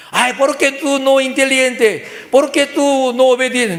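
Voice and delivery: acted exasperated complaint; scolding parent. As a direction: berate a child in a mock parent voice. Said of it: "No hay que decir eso".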